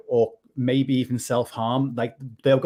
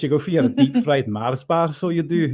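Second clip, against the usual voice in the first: teasing put-on Scottish accent, a touch louder; 3 semitones higher, 3 dB louder than usual. Teasing a Scottish co-host about fried Mars bars, in a Scottish accent. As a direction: play up the Scottish lilt on so you do. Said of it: "That's a good Scottish accent".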